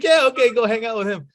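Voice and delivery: high-pitched, loud imitation of the brothers; brothers' breezy approval. Brothers send the kid off with a stranger, high mock approval.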